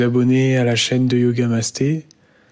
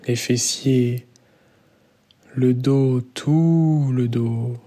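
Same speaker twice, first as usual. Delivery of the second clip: guided meditation. Real, not acted. Yoga teacher guides students lying down into Yoga Nidra, calm guided meditation.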